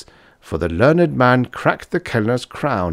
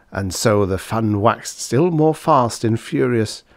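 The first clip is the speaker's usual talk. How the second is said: ordinary delivery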